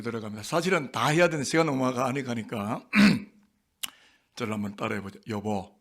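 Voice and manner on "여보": ordinary talk, nothing marked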